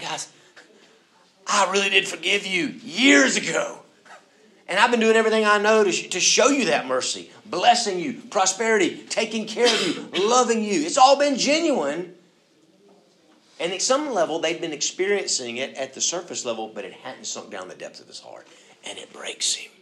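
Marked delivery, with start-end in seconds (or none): 0.0-12.1 higher and louder, pouring it out; earnest plea. Acted. Joseph pleading with his brothers to believe his forgiveness. 18.8-19.7 whispered. Real, not acted. Quiet aside.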